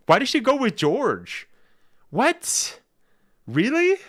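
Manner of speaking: very high, loud disbelief; incredulous surprise. Real, not acted. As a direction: squeal it in sheer astonishment. Reacting to surprising story news.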